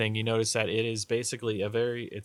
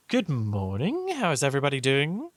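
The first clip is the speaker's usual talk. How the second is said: lilting, sing-song ladylike greeting; Lady Bianca; 4 semitones higher than usual. Acted. Lady coming over to greet the travellers, lilting greeting. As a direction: stretch the greeting, then lift the pitch brightly. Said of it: "Lady Bianca comes over and she goes".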